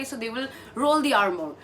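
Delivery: usual speaking voice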